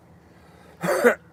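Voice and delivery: high, loud burst of laughter. Real, not acted. Laughing at own silly impression, high loud laugh. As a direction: let out a sharp high laugh.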